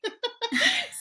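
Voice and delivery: loud, high peal of laughter; delighted laugh; big laugh. Real, not acted. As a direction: laugh hard at her own joke. Joke about husband as publicist.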